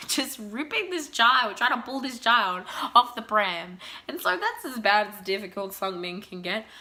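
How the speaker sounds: ordinary talk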